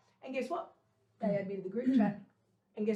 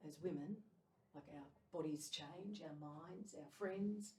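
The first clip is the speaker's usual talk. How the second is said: reflective monologue; 5 semitones lower, 9 dB quieter than usual. Acted. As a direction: let each image land quietly. Reflective poetic closing.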